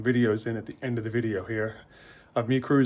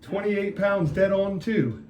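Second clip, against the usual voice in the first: higher, slow amazed remark; surprised; 7 semitones higher, slower than usual. Real, not acted. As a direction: drag it out in disbelief. Finds both wheels weigh same.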